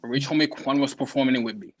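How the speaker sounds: usual speaking voice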